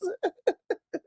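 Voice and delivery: high, breaking chuckles; real fit of giggles; helpless laughter. Real, not acted. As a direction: laugh uncontrollably. Laughing at the prince.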